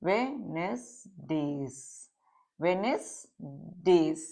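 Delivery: soft, low, drawn-out वे-नेस-डेज with gaps, first D silent; slow syllable-by-syllable. Shown as a demonstration. Teacher dictating the word to students, slowly by syllables. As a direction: sound out Wednesday's slowly and softly, dropping the first D.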